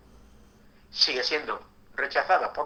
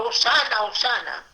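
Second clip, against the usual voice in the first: higher, louder hosana shout; crowd cheering; 9 semitones higher, 5 dB louder than usual. Retold or imitated. Preacher voices the crowd praising the Messiah, cheering.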